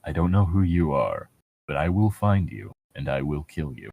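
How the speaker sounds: gravel-deep movie threat. Retold or imitated. Quoting the Taken threat to a friend, deep menacing movie voice. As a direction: sink low and quiet like a movie killer.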